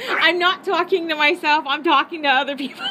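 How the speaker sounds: giggling through the excuse; laughing. Real, not acted. Laughing to friends on the phone about talking to herself.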